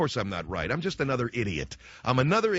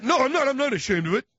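put-on high, loud childish protest; imitating an indignant child; defensive kid; 12 semitones higher, 5 dB louder than usual. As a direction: protest hotly in a high kid's voice. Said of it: "what are you defensive about it for".

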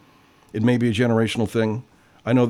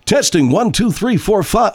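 mic test; 8 semitones higher, 4 dB louder than usual. Shown as a demonstration. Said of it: "So in other words, testing".

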